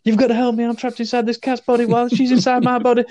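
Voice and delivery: high, imitated frantic plea for help; panicked plea. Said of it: "and he's like".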